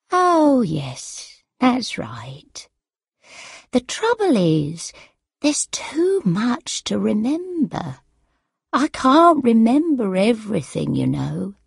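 frail mother, pitch swooping high then falling; forgetful elderly mother. A narrator's reading. Mother admits memory trouble to daughter, frail and forgetful. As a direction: explain helplessly as a muddled old mother.

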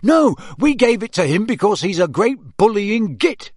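high, loud, emphatic protest; indignant. A narrator's reading. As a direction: back up the protest hotly. George joins the indignant protest.

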